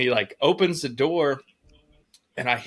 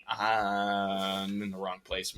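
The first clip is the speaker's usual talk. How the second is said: dawning realisation; 4 semitones lower, 7 dB quieter, much slower than usual. Retold or imitated. Realising a mistake, retold to himself.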